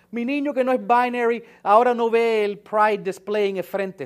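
hammed-up crying mom wailing; sarcastic impression of a distraught mother; mocking a weeping mother. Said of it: "las madres que veo llorando".

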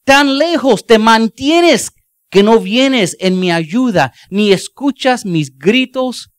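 loud, slow, pleading cry to God; anguished psalm cry. Acted. Preacher voicing King David crying out to God, slow and loud. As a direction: cry out slowly, as if abandoned. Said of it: "David escribió".

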